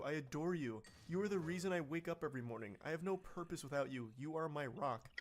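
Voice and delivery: usual speaking voice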